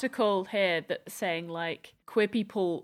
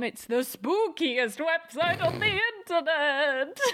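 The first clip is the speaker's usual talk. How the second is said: spooky voice; 7 semitones higher, slower than usual. Acted. Spooky plug, hammed.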